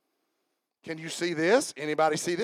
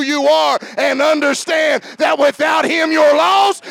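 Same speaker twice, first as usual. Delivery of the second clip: high, loud, hammering preaching; 9 semitones higher, 13 dB louder, slower than usual. Real, not acted. Preacher urging congregation to strip off pride, building to a shout. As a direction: drive each phrase home at a shout.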